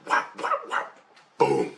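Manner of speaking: breathy whap sounds ending in a boom; voiceless whap whap whap, then boom; imitated impacts. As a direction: mouth the hits like a sound effect. Imitating blows of energy hitting him.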